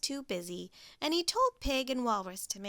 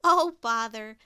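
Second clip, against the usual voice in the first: 1 breezy dismissal; 9 dB louder than usual. Acted. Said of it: "smiled Pig".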